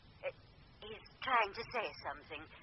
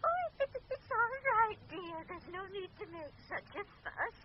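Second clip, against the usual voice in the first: giggly; 8 semitones higher than usual. Acted. Medium laughing at spirit.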